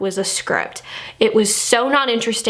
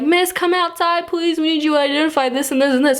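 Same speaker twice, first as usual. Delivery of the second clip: lightly voiced sheriff, higher, last line fast; a polite officer, voice pitched up; sheriff at the door; 6 semitones higher than usual. Reviewer imitating the sheriff knocking on the door.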